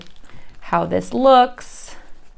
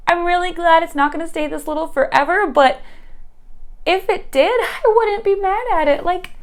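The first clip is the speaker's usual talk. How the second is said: excited squeal; 9 semitones higher than usual. Real, not acted. Gushing over tiny plant.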